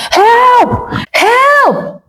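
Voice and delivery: high, frantic cry for help; husband shouting for the neighbours; desperate shout. A narrator's reading. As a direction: yell for anyone to come. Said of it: "He shouted".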